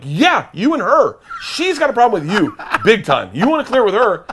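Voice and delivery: high, loud, emphatic comeback; loud blame-shifting. Retold or imitated. Husband telling truck driver it's his wife, loud blame-shifting. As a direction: push it all onto her, loudly.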